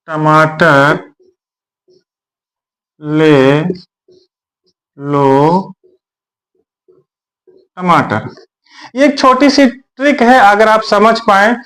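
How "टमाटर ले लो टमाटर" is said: slow, low hawker's cry, drawn out word by word; vegetable seller's call. Retold or imitated. Teacher imitating a tomato seller calling to buyers, slow low cry. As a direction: call out like a street vendor selling tomatoes. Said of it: "हमने जैसे आपसे कहा".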